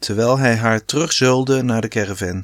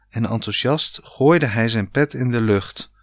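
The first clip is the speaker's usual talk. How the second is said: plain reading voice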